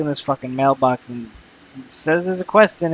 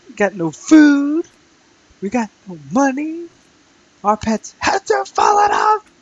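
squeaky high complaint, pitched way up; mock whiny high voice; 11 semitones higher, 4 dB louder than usual. Gamer whining in a mock peasant voice. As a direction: whine like a broke peasant, high and nasal-free.

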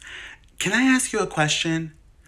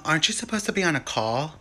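normal talking voice

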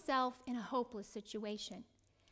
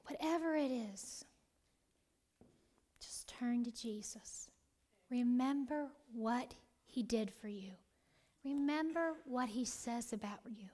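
hushed whisper; 5 dB quieter than usual. Real, not acted. Preacher whispering encouragement to her congregation. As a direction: whisper it close and gentle. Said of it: "soft intimate urging to congregation".

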